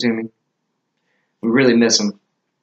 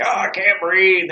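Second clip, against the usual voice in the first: higher-pitched mock breathless plea; imitated drunkard's complaint; 8 semitones higher than usual.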